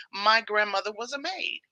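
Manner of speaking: normal talking voice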